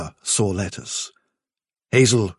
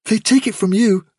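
high, louder little voice; sudden eager interjection; 11 semitones higher, 5 dB louder than usual. A narrator's reading. Fiver interrupting the chief, suddenly urgent. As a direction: cut in suddenly, pitched right up. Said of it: "said Fiver suddenly".

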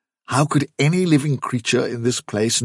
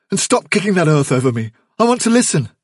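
raised, urgent complaint; tense order climbing in pitch; sharp scolding; 9 semitones higher, 5 dB louder, faster than usual. A narrator's reading. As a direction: scold him, growing more insistent. Hazel scolds Bigwig, straining to listen.

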